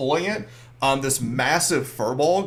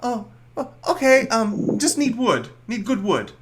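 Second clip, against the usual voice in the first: timid little creature; 9 semitones higher than usual. Acted. Little one answering the shipwrecked player, high timid voice. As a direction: mumble it like a shy tiny helper.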